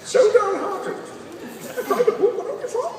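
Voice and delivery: slow, high-strained mock effort; constipated Batman. Batman on the toilet with nothing happening, comic straining. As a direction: strain every word out, hammed-up. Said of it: "someone who really constipated".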